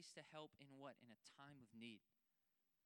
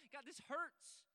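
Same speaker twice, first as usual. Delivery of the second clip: higher, louder, slow cry of a struggling believer; anguished prayer; 10 semitones higher, 10 dB louder, slower than usual. Pretend. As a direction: pour out your pain to God. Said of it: "Just tell him".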